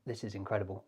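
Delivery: ordinary delivery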